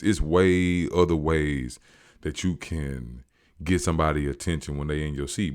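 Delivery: ordinary delivery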